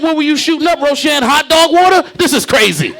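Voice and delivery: high, loud roast; mocking shout. Comic roasting Roshan across the cubicle, high and loud. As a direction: yell the insult up high, laughing. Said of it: "I said".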